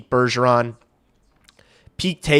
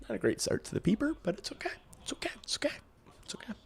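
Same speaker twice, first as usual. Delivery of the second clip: soft, fast whispered self-soothing; 6 semitones higher, 18 dB quieter, much faster than usual. Real, not acted. Streamer quietly reassuring himself after a bad start, whispering. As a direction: whisper it to calm yourself.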